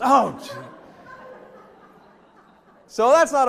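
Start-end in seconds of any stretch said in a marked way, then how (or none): none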